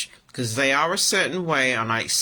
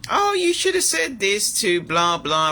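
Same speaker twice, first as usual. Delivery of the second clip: higher, mocking nag voice; imitating a bossy coworker; 7 semitones higher, 3 dB louder than usual. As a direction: parody her lecturing tone, lightly. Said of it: "and they're saying to me".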